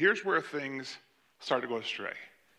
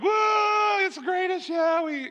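put-on much higher, louder squealing kid shout; imitating an over-the-moon second grader; mock excited girl; 3 dB louder, slower than usual. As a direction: gush like a thrilled little girl at practice. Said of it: "and it's all excited".

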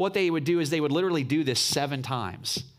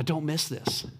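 ordinary talk, nothing marked

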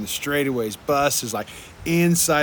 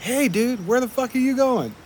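retold yell; 11 semitones higher than usual. Rider yells after a passing cyclist, high retold call-out. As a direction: call after someone riding off, mildly raised. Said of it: "so I yell at him".

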